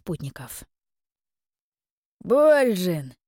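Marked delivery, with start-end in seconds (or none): 2.2-3.1 loud, high hearty call of a name; cordial drawn-out call. A narrator's reading. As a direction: stretch the name out in welcome. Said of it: "радушно протянул".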